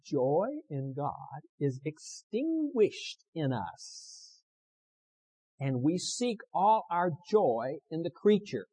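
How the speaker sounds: very slow, drawn-out pulpit reading; dramatic quotation reading. Acted. Preacher finishing a quotation on joy in God, slow dramatic reading. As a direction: stretch each phrase of the quotation solemnly.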